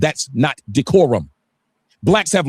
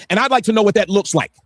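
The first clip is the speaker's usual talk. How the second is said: ordinary delivery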